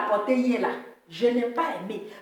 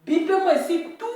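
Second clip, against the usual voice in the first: calling out; 8 semitones higher, 4 dB louder than usual. Acted. Calling out to lost sheep.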